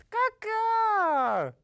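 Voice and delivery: high, loud caw swooping down. A narrator's reading. Father crow answering his mate, loud high caw. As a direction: leap up high, then let the caw slide down. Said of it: "And Papa Crow said".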